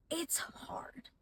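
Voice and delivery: breathy whispered admission. Real, not acted. Mother confiding to other moms, whispered. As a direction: drop to a whisper, like a confession. Mom admitting motherhood is hard.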